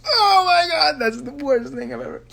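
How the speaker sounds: high, whiny mock wail; retold mock horror. Singer retelling seeing the cover posted, mock-horrified wail. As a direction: wail it in exaggerated dismay, starting very high. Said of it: "and I was like".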